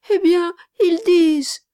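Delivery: put-on high, loud child's voice; insistent little boy explaining himself. A narrator's reading. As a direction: burst out as an earnest small boy. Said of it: "reprit le petit garçon".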